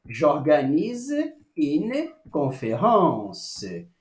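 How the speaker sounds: slow, careful French "J'organise une conférence"; slow French model. Shown as a demonstration. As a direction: say it slowly for students to copy. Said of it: "teacher repeats French phrase".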